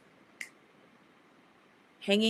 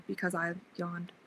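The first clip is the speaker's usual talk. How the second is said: ordinary talk, nothing marked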